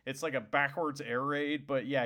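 ordinary talk

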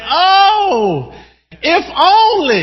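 high, drawn-out longing cry as God; God's excitement bursting out, high and slow; excited exclamation. Acted. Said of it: "it's really His excitement".

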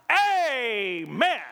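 high, loud drawn-out church cheer; imitating Charlie's shout. Hospitalized church member shouts for his pastor, imitated exuberant shout. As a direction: belt it out like an enthusiastic congregant. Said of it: "That's how he".

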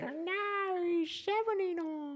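put-on high, slow old man quaver. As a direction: play a cranky old man. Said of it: "It's just kind of old man voice".

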